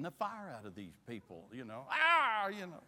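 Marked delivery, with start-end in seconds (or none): 1.9-2.5 sudden high loud yelp of pain. Retold or imitated. Telling of the pageant beating, a sharp high yelp. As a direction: yelp like a child being beaten with sticks.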